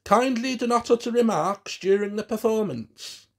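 high, loud announcer's instruction; William announcing his show. A narrator's reading. William warns his audience before the puppet show, loud announcement. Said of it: "William's voice came through the front".

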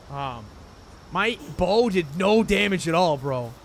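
ordinary talk

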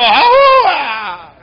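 put-on drunkard's yell. Preacher mimicking a drunk in the street, whooping yell. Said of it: "Cuando echa esos gritos".

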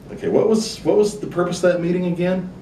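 a hint of a baffled customer asking what that was for; puzzled customer. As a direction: play the confused client, rising and baffled. Customer puzzled after visit.

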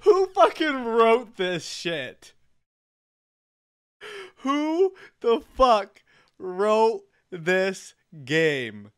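slow, loud disbelieving shout; incredulous yell. Real, not acted. Outraged laughing at game writing.

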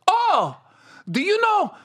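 high, excited Filipino nurse; Filipino accent. Retold or imitated. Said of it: "She goes".

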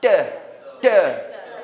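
"때" punched loud as the joined t-h syllable; short, loud "때"; linking syllable. Shown as a demonstration. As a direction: isolate "때" loudly. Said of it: "여는".

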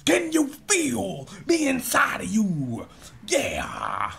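high, slow, booming preacher parody; mock evangelist. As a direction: moan it out like a hammed-up revival sermon. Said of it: "almost positive they're not fucking their wife".

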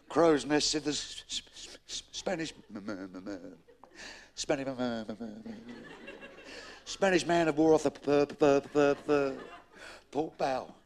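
catching repeatedly on first consonants; hammed-up halting stutter; acted stammer. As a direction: force the message out through a heavy stutter. Stuttering sailor relays the sighting.

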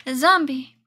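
loud alarmed shout; startled loud warning; startled cry. Real, not acted. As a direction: call out the sudden zombie. Zombie suddenly appears.

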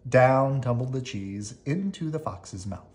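usual speaking voice

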